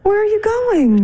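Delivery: high, slow, stretched question; indignant alarm. Acted. Wife stopping husband going to bed, indignant.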